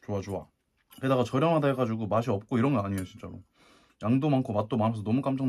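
speaking with food in mouth; mumbled between bites; chewing while talking. Real, not acted. Seen 3: Mouth works on food; spoon goes in near 3.1 to 3.4.

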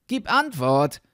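commanding call. A narrator's reading. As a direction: demand a reply loudly. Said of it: "rief der Junge".

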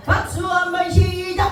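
high, chanted clap cue with a stretched last word; calling the cue. Real, not acted. Instructor starting the group's clapping, high sing-out call. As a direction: call out the count-in on a high held note.

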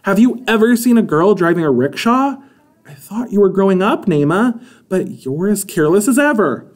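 scolding. A narrator's reading. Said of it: "Mother scolded".

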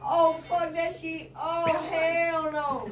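real panic at his own moving arm; panicked shout. Real, not acted.

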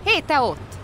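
high, loud policeman's shout; stern call-out. A narrator's reading. Bear policeman shouting at a barking pug. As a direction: call the little dog to order. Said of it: "kiáltott rá Kóbor Muki".

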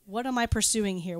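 normal talking voice

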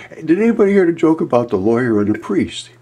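loud, high call to get the room's attention. Retold or imitated. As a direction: raise your voice and grab the table. Calling out to the group.

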